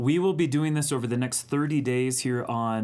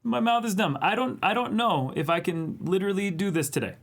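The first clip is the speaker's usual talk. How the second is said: whiny plea; 9 semitones higher, 5 dB louder than usual. Retold or imitated. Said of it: "I was like".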